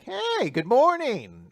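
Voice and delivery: long, drawn-out high, loud greeting; mock cheery taunt. Catching Anzai out on camera, mock-cheerful greeting. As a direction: stretch it out in teasing singsong. Acted taunt of caught villain.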